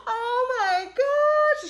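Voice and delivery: high, loud, drawn-out gasp of disbelief; mock shocked wail. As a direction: wail it slowly, imitating her shock. Acting out her shock at airport.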